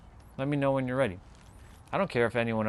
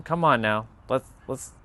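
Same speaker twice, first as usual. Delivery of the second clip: ordinary talk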